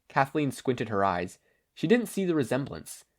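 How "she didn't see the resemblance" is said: usual speaking voice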